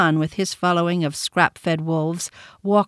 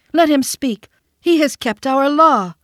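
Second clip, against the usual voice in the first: higher, louder pack yells; 7 semitones higher, 6 dB louder than usual. A narrator's reading. Wolves yelling at the council, loud. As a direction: shout from the crowd. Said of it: "There were yells of".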